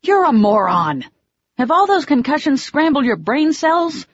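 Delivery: high, loud upset rebuke; angry scolding. Acted. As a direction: tell him off, still shaking. Scolding him after his dive.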